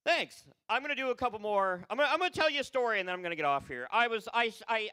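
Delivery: ordinary talk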